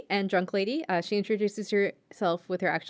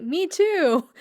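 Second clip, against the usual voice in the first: chirpy bandmate; 9 semitones higher, 4 dB louder than usual. Retold or imitated. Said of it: "someone else is like".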